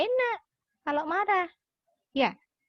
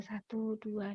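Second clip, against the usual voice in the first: very soft, low count; calm counting; 4 semitones lower, 11 dB quieter, much slower than usual. Shown as a demonstration. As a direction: count under your breath to cool off. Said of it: "hitung satu sampai sepuluh". (hitungan lirih untuk meredakan marah)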